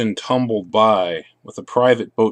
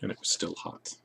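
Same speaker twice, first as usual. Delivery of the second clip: soft unvoiced last line; whispered ending; 5 semitones lower, 18 dB quieter, faster than usual. Reader whispering the tender ending to the child.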